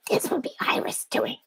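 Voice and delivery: grotesque whispered monster voice; breathy creature rasping an ASMR whisper; Iris monster voice. Pretend. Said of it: "It hurt me just to do that little bit of Iris's voice".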